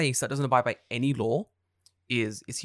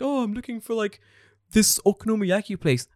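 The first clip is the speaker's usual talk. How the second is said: high, startled mock apology; tourist's apology; 8 semitones higher than usual. Tourist in the road apologising in a high, sheepish mock voice. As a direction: squeak out a sheepish sorry. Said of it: "He's like".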